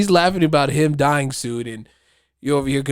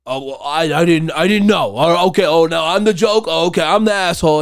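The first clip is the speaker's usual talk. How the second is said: louder, higher indignant whine; mock outraged customer; 6 semitones higher, 7 dB louder than usual. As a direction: play the wronged victim, loud and high. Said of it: "he's like".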